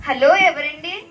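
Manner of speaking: ordinary talk, nothing marked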